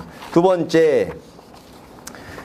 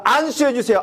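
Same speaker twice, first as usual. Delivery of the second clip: mocking request; 7 semitones higher than usual. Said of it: "방법을 자기가 왜 정해 오냐고요".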